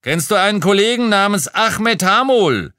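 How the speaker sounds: high, loud shouted question; full-voiced hailing shout; bellowing through noise. A narrator's reading. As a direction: yell over the din to a worker. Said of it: "brüllte ich durch den Lärm".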